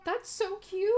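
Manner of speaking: high, loud squeal of delight; gushing fangirl squeal. Real, not acted. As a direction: shriek it like it's the cutest thing ever. Squealing at cute villagers.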